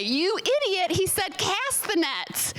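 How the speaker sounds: lightly voiced annoyed disciples; exasperated disciples. Disciples scolding Jesus in the cartoon, mock exasperation. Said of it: "and the disciples say".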